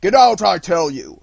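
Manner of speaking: loud, menacing yell; threatening shout. Acted. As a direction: kick the dog away with your voice. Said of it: "repeated the fisherman".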